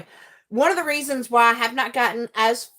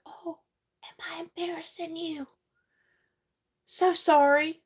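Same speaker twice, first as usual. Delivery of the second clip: soft, breathy fake apology, higher; mock innocent whisper; 6 semitones higher, 5 dB quieter than usual. Mom teasing her embarrassed kids, mock innocent whisper. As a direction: tease the embarrassed kid in a hushed mock-sorry voice. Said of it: "you're just like".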